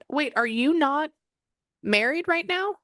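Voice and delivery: high, rising disbelief; real surprised double-check; startled question. Real, not acted. Startled by unexpected image, checks.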